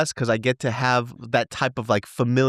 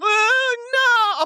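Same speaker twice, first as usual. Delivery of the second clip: put-on much higher, wailing baby voice; toddler whimper; 4 dB louder than usual. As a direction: imitate a little one starting to cry. Imitating crying toddler.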